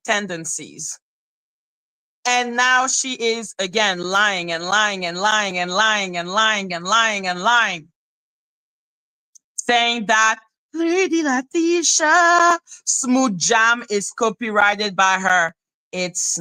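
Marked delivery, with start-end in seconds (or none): 4.0-7.9 rolling, chant-like list that keeps going; singsong repetition. Real, not acted. Streamer mocking her rival's endless lying, singsong repetition. Repeats word to mock endless lies. 10.7-12.6 high, slow, stretched-out mock title; mocking title call. Streamer mocking the title her rival claims, mocking title call.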